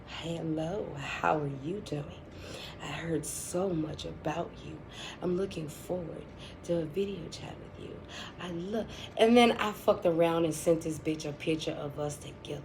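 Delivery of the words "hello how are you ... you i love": hushed, low mock-polite phone voice; soft phone greeting. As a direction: greet them very softly and low. Acted sweet phone greeting to friend's husband.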